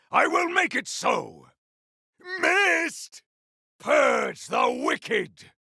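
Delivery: a warrior shouting combat callouts, fierce and proud; defiant warrior battle cries; battle barks. Acted. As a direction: bark each line like a fighter mid-battle.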